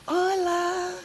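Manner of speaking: put-on high-pitched little kid saying Hola; kids' greeting. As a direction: mimic the small children's chirpy hello. Kids greeting her on stairs.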